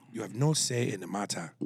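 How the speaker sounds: understated random African man voice; African accent. Acted. Host recalls his stock line, in a random African accent. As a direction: put on a generic African accent. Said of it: "doing a random African voice".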